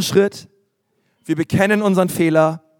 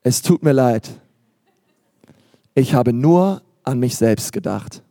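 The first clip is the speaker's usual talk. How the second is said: low, measured mock confession; acted apology; 5 semitones lower than usual. Preacher models apologising to a spouse after conflict, understated contrite apology. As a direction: deliver a lowered, slowing admission of fault. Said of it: "dieser Satz lautet".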